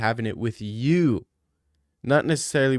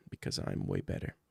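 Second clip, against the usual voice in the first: whispered; 12 dB quieter than usual. Pretend. Whispered end of boast.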